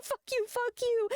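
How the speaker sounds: hammed-up fury at Rob Schneider; mock furious retort. Streamer ranting at Rob Schneider, high mock fury. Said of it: "I'm like".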